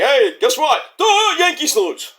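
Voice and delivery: mock a rival fan like a dimwit; hammed-up goofy gloat to Yankee fans; dopey taunt. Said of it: "It's like".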